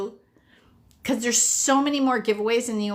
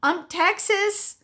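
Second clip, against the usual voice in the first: much higher, gushing squeal, slowing on Texas; excited friend; 11 semitones higher than usual. Retold or imitated. Friend from Idaho inviting her as plus one, high excited gush. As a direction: gush like a friend who just won a trip. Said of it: "and she goes".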